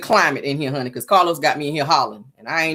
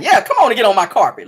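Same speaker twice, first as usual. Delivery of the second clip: a touch higher and louder, inviting; mock carpet hustler; 6 semitones higher, 5 dB louder, faster than usual. Host voicing the reality star luring riders, lightly hammed-up. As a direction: beckon them onto the carpet, hammed-up. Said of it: "He got his hands out like".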